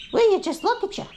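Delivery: high sing-song scolding of pets. Real, not acted. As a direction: coo at the messy ducks like babies. Cooing at ducks.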